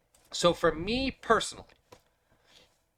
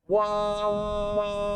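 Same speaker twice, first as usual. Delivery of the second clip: pitch sweep effect; 7 semitones higher than usual. Shown as a demonstration. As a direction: voice swept high through a filter. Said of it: "you can also do fun things like this".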